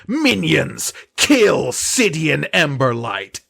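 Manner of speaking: slow, loud haughty duchess over a loudspeaker; imperious plummy grande dame. Acted. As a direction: sneer down at them from above. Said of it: "with the Duchess Rose's voice pipes through".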